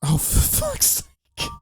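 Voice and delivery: breathy, high exasperated groan; fed up with the interruption. Real, not acted. Phone rings mid-recording.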